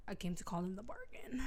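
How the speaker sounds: low, hushed menace, acted; ominous whisper. Reese crashing the wedding to Feyre, ominous whisper. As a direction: whisper it as a sly threat.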